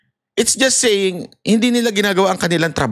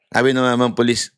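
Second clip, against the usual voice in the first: ordinary talk, nothing marked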